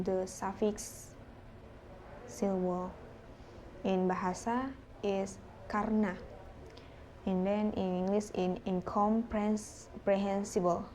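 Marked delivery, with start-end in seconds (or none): none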